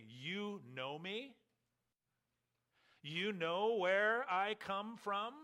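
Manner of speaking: slow, ironic rise on both questions; sarcastic question. Shown as a demonstration. Jesus ironically questions the crowd's claim, sarcastic and slow. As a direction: throw their claim back at them, mocking it slowly. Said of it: "sarcastically or ironically saying".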